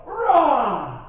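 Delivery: sudden loud high roar; angry roar. Retold or imitated. Husband snapping at his wife with a roar. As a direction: let out one wordless blast of temper. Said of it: "And I go".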